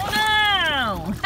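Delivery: usual speaking voice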